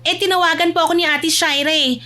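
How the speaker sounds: high, polite younger voice; apologetic daughter. Acted. Abby excuses herself to her mother, apologetic.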